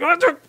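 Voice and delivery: squeaky mock panic cry; high-pitched startled yelp at a squirrel. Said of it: "you're like".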